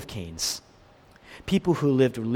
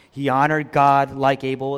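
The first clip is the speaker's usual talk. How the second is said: ordinary talk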